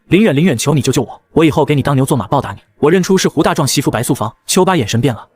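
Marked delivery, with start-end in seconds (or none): none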